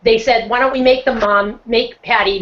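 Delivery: ordinary delivery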